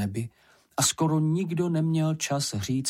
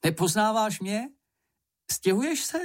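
eager questioning; 10 semitones higher than usual. A narrator's reading. Moomin hails a passing acquaintance.

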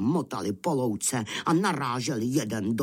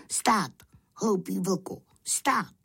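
high lamb voice ordering a halt; commanding little lamb; 9 semitones higher than usual. Acted. (rázný povel vysokým beránčím hláskem)

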